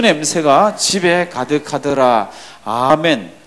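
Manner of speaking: loud, slow, measured Bible recitation; scripture reading aloud. Acted. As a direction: lead the congregation through the verse, strong and drawn out. Leads congregational scripture reading. (크고 느리게 또박또박 성경 봉독)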